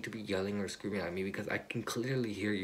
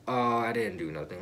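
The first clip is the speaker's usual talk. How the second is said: louder, brushing it off; mock dismissive friend; 3 semitones higher, 7 dB louder than usual. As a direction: shrug it away loudly, mocking them. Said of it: "And they'd be like".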